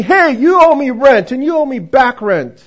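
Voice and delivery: put-on old landlord yelling for back rent; banging and yelling. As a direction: holler the demand while pounding. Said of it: "and he's banging".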